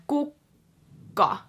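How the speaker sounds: kuka, exaggerated hold; overdramatic. Shown as a demonstration. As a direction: ham up the pause on the K in kuka. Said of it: "that sounds very dramatic and not natural".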